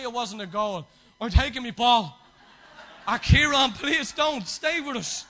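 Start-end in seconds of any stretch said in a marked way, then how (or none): 3.1-5.2 put-on louder, higher, laughing plea to a boy; mock pleading. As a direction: beg Kieran to stay, half laughing. Kids pleading with ball owner.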